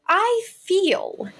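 "I feel" pitched high and drawn out, word by word; emphatic "I feel". Real, not acted. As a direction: set "I feel" apart, high and deliberate. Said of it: "I'm using I feel statements".

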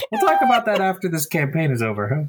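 high, giggly embarrassed denial; flustered laughing. Real, not acted.

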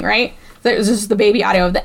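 ordinary talk

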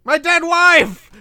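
high, slow, desperate yelling; panicked movie shout. Retold or imitated. Podcaster shouting a horror movie line at his cohost, panicked yell. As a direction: scream it out like a terrified captain calling for help. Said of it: "Doctor Weird's great line".

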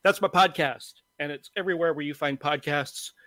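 normal talking voice

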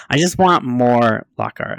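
usual speaking voice

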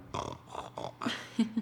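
rasping grandfather snore imitation; imitated snoring. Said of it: "my grandfather snoring".

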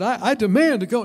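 loud pretend ultimatum; mock demand. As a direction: insist like a spoiled kid. Retold demand to teacher.

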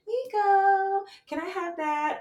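high, sing-song gentle ask; sing-songy. Shown as a demonstration. Gentle mom asking her son for the comb, sing-songy. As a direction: lilt it up high like a sweet request. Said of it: "I was very sing-songy".

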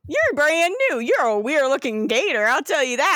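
put-on lilting, animated Southern Florida accent; Southern Floridian gator. Gator questioning an odd newcomer, Southern Floridian accent. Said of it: "the gators are the full Southern Floridian".

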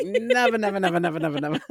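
high, loud sing-song never; mock parent chant of never; imitating the quote. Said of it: "I was like".

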